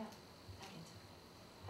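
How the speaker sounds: slow, breathless whisper. Retold or imitated. A hurt believer telling God she's fine, whispered slowly.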